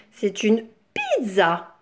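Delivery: ordinary delivery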